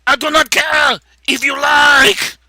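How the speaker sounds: loud, slow shouted Austrian-accent impression; Arnold Schwarzenegger. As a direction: bellow it in a hammed-up Austrian accent. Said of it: "talk like Arnold Schwarzenegger".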